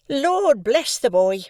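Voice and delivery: high, loud, slow exclamation; exclaiming sister. A narrator's reading. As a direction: exclaim it, exasperated. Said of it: "exclaimed my sister".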